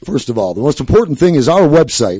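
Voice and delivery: booming DJ promo read; radio voice. Shown as a demonstration. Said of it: "give me the radio voice for".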